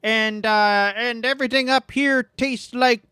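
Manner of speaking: hint of a chirpy Michigan heel; mock Michigan wrestler. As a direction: tease the crowd like a friendly out-of-towner. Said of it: "he's like, from Michigan".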